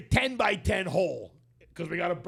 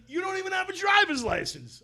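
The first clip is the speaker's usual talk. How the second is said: retold shouted retort; 13 semitones higher, 3 dB louder than usual. Arguing with Nick about driving.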